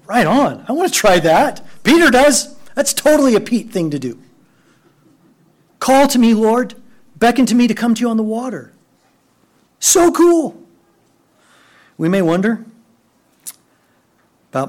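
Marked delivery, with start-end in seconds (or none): 0.1-1.6 mock eager. Imagined eager reaction. 5.8-8.7 high, fast eager plea; excited calling out. Retold or imitated. Peter calling to Jesus. 9.8-10.6 high thrilled exclamation; delighted. Real, not acted. Enthusing about Peter.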